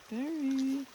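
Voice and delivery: calling a name across the river. Real, not acted. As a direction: call out to someone far away.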